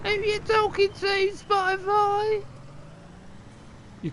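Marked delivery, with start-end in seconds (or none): 0.0-2.4 very high monotone squeal of a question; mock indignation. Streamer challenging Spotify's ad, mock indignation. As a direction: hold one high note, dragging the last word.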